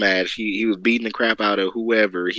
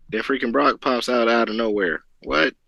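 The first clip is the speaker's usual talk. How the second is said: ordinary talk, nothing marked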